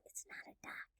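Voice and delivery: very soft secret whisper; whispered aside. Real, not acted. Reader tells children duck is disguised, hushed whisper. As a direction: breathe it barely aloud, as a secret.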